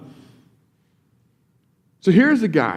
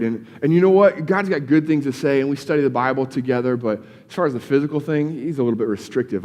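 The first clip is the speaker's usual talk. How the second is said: ordinary talk, nothing marked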